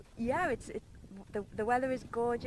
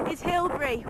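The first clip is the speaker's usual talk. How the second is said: ordinary talk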